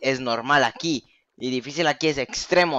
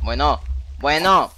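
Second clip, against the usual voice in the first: exasperated outburst; 3 semitones higher, 9 dB louder, slower than usual. Real, not acted. Reacting to creepers appearing.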